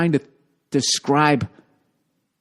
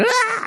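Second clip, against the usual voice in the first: sudden high yelp of pain; startled yelp. Retold or imitated. Bitten woman's sudden high yelp. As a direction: yelp like you just got bitten.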